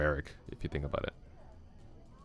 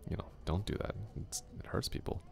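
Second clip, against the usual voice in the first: whispered, like a scolding conscience; hushed moral reminder; 4 semitones higher, 8 dB quieter than usual. Retold or imitated. Speaker voicing what people say, whispered. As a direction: breathe it like a quiet warning.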